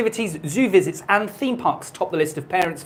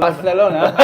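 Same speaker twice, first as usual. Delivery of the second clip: mock Spanish accent. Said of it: "You gotta do the accent right".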